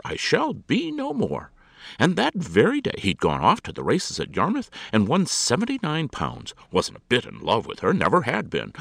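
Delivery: high, loud, emphatic gossip; indignant lady holding forth; scornful society hostess. A narrator's reading. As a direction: scoff at the idea with theatrical disdain.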